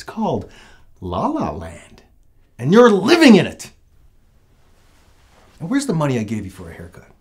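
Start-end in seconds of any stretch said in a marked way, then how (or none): 2.6-6.7 loud, fast angry outburst; angry shout. Acted. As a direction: yell it at your son. Angry dad scolding his son.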